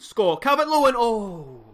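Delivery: loud, high yell of the name; goal shout. Real, not acted. Gamer shouting as his striker scores, thrilled.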